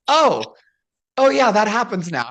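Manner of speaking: playful startled recognition; surprised realization. Retold or imitated. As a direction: act out a sudden dawning. Retold realization in ring.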